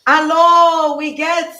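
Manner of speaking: high, loud joyful hello; excited hello. Real, not acted. Host greeting a viewer joining the stream, excited. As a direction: shout hello with delight.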